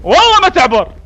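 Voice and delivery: defiant shout. Acted. Colonel vowing the MP will not pass, defiant shout. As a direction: swear it at the top of your voice. Colonel vows to block the road.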